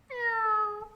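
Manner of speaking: high squeaky puppet meow; a tiny cat mewing for the kids; kitty meow. Acted. Puppet kitty greeting the children, high meow.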